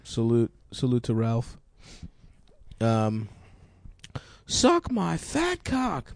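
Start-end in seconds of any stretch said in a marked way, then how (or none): 4.5-6.1 slow mock yell. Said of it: "Maybe they shoulda yelled that".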